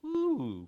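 high, soft admiring coo; admiring ooh. Retold or imitated. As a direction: coo as if impressed with yourself. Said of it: "everybody else ... think".

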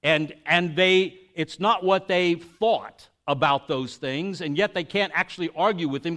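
ordinary talk, nothing marked

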